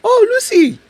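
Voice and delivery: very high mocking voice for the ex; high-pitched parody of the flirting ex; hammed-up smooth guy. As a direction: squeal out a mock sweet greeting. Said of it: "the guy goes like".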